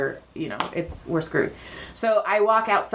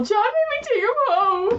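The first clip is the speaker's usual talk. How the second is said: high-pitched mock protest. Massage therapist answering clients about missing gnomes, high mock protest. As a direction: squeal it out very high.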